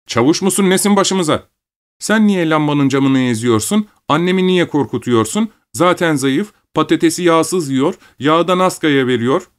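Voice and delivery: put-on high-pitched, indignant child; scolding young boy, indignant. A narrator's reading. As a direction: take father to task as his small son. Son rebukes his father. (babasına çıkışan küçük oğlan)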